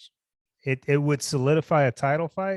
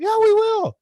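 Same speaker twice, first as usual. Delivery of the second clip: much higher and louder sarcastic retort; mocking taunt; 9 dB louder than usual. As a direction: fling it back with a sneer. His own taunting reply.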